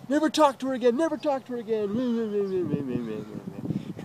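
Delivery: high mock scolding sliding down into babble; mocking parroting. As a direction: parrot the warning, dissolving into mehs. Mocks warning with meh meh.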